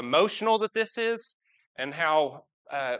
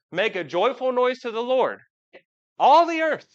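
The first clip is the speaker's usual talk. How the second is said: higher and louder, building to a ringing shout; joyful proclamation; 7 semitones higher, 6 dB louder than usual. Shown as a demonstration. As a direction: proclaim it like a real joyful noise. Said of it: "what sound is that?".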